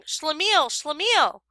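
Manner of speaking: calling out across the street, high; shouting a name. A narrator's reading. Friend shouting from a taxi window, calling out. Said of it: "I heard someone shouting".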